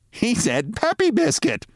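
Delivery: high, slow woman's voice, amused; a passing woman tickled by what she heard. A narrator's reading. Woman to her companion, high amused voice.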